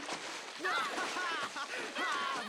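mean teasing cackle; mocking laugh. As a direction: laugh at him.

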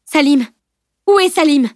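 loud, high anxious call; worried calling out. A narrator's reading. Camille calling anxiously for her missing friend. Camille suddenly searching for Salim.

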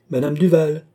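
high, loud summons; calling out. A narrator's reading. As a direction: call a servant through the door. Queen summons woman beyond door.

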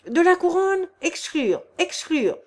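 loud, high angry outburst, then hammering exclure; an indignant crowd demanding exclusion; furious shout falling to a chant; angry crowd cry. Acted. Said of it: "avec l'accent de la colère".

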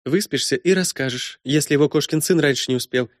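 high, brisk, bossy woman; brash princess voice. Acted. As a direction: play her as a bold, teasing princess. Matilda voiced by narrator.

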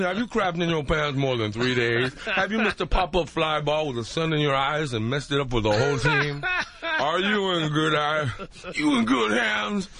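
Allstate guy voice. Retold or imitated. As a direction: read it like a solemn insurance spokesman. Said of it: "Here is the Allstate guy".

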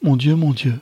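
a mother's alarmed outburst; loud, high exclamation of distress; dismayed cry. A narrator's reading. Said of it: "s'écria madame Nélis".

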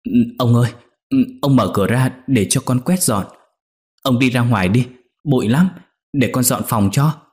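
jumpy, nervous begging to be let out; startled pleading. Acted. Maid trapped in the room, pleading with the master, startled. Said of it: "Con Nhị giật mình kêu lên".

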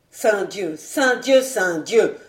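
loud, furious oath said three times; stamping, cursing outburst; angry exclamation. A narrator's reading. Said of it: "s'écria par trois fois".

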